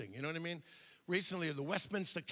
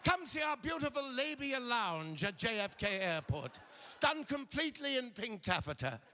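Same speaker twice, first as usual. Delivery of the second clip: raised, lilting mock commercial voice; airline ad pitch; 4 semitones higher than usual. Comic voices a cheeky airline ad for Ireland, mock announcer pitch. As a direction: sell it like a spoof airline ad.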